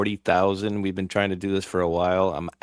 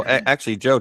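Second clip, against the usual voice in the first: ordinary talk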